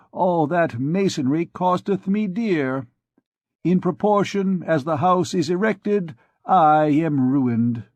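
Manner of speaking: boast, then moan about the cost; complaining physician. A narrator's reading. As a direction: physician grumbling to the archdeacon about his house.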